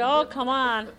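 pleading. Acted. Rabbit pleads for the jacket.

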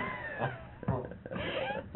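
high breathy burst of laughter. Real, not acted. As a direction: crack up at the punchline. Punchline of the story.

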